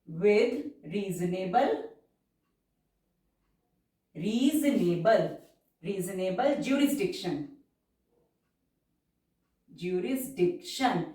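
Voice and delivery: very slow, drawn-out dictation pace; stenography dictation, stretched syllables. Shown as a demonstration. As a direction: read it out word by word for shorthand.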